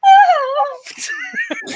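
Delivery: loud high-pitched scream; high shriek. Retold or imitated. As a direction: shriek like unleashing power. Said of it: "she's like".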